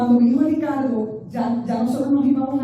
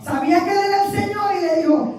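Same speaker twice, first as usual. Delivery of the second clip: ordinary talk, nothing marked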